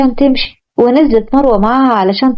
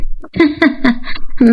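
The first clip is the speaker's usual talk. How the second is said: amused laugh. Acted. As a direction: laugh at his relief. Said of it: "ضحكت مروة وقالت".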